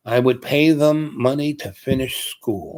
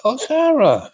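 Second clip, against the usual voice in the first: high, louder "oh" of sudden recognition; surprised recognition; 5 dB louder, slower than usual. Retold or imitated. Man suddenly recognizing an old friend on the street, high surprised exclaim. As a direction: realize who she is, pleasantly startled. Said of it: "And I said".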